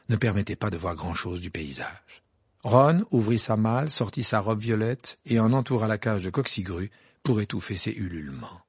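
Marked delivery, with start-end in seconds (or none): none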